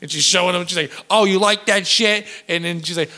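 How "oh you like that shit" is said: slow, higher imitated Long Island accent; New Jersey accent. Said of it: "she's talking like my cousin Vinny".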